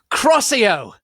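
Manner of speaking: high loud spell cry; shouted spell. A narrator's reading. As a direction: fire the curse at full voice. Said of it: "I shitted".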